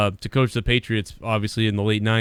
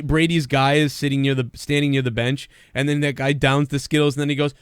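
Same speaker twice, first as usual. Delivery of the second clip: ordinary talk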